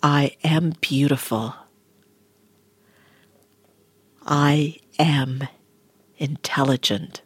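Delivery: soft, low meditation whisper; guided meditation. Acted. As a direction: guide them gently into calm.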